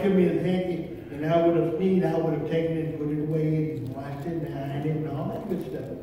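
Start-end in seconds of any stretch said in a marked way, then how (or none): none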